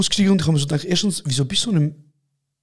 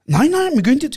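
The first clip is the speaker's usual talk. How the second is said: insisting; 10 semitones higher than usual. Retold or imitated. Janitor insists in quoted argument.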